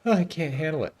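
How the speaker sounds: high, winded mock complaint; hammed-up exhausted whine; mock out of breath. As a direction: imitate a hero who has run out of puff. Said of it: "getting out of breath".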